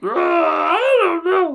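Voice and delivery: put-on very high, slow, whiny drunk wail; Barney from The Simpsons. Podcaster tries a Barney impression for his co-host, very high and slow. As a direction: stretch it out like a sobbing drunk. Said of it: "remind me of Barney from The Simpsons".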